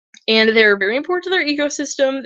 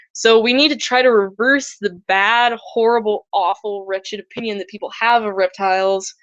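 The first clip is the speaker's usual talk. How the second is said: usual speaking voice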